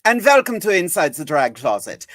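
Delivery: put-on theatrical German accent greeting; Frau Blucher welcome. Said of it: "I'm not doing that accent all night".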